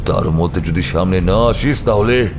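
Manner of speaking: menacing roared threat; tiger-like roar. Acted. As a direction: challenge the hidden enemy, surging upward on key words. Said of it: "বাঘের মতো গর্জন করে বলে উঠল".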